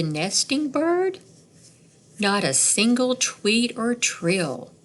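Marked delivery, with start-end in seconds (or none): none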